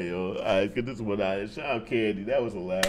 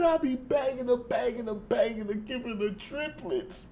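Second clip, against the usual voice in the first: high, incredulous squeal fading into a laugh; amazed mock quoting; 11 semitones higher than usual. Reactor quotes the rapper's triplets back in delight, amazed high squeal. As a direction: repeat it in high-pitched disbelief.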